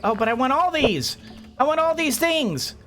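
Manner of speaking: high, playful whine of wanting; mock pleading. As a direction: beg like a greedy child, half in jest. Mock begging to keep all items.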